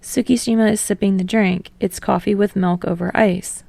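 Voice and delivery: ordinary delivery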